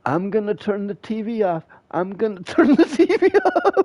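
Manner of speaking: high shrill mock mom, breaking into laughter; nagging mom. Mom repeating empty TV threats, high mocking voice. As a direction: nag on repeat, cracking up. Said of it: "She'd be like".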